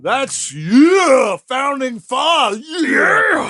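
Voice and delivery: loud, high, exasperated outburst; outraged shout. Real, not acted. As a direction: shout it in disgust. Outraged at Jefferson's age gap.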